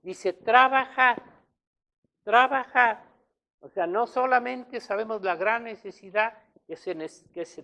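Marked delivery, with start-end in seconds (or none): 0.3-3.1 emphatic command. Acted. Predicador repite la orden enfático.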